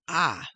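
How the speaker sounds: a lone open "a"; vowel sound. Shown as a demonstration. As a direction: isolate the a vowel. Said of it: "There's that vowel sound".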